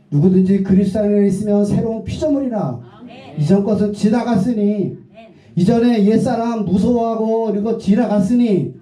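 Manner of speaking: preacher building to a climax; fervent preaching. Acted. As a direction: proclaim it with conviction. Preaching at full force.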